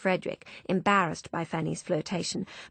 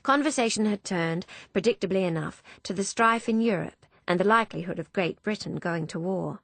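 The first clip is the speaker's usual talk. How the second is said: ordinary delivery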